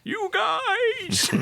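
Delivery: high squeal of laughter through the words; laughing. Real, not acted. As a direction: giggle it out, cracking up. Laughing at the bit.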